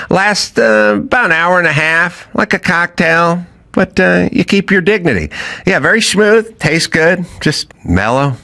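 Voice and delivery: upbeat sponsor read for a seltzer; friendly host ad voice. Acted. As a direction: sell it like a podcast ad.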